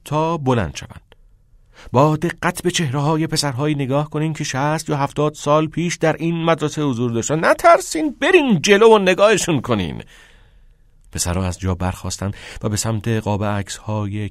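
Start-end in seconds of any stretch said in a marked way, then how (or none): 1.9-10.0 raised, urging teacher, rising to a high call; impassioned teacher. Acted. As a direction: urge the pupils forward, louder at the end.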